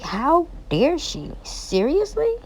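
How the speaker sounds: high, incredulous how dare she; aghast, voice climbing; shocked indignation; outraged disbelief. Real, not acted. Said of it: "I'm like".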